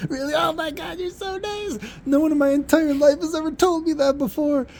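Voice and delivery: put-on high, teary falsetto of a girl overwhelmed by kindness; a high crying girl voice, imitated; imitated bawling. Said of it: "like, crying, like, bawling".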